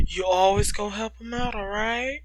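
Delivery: usual speaking voice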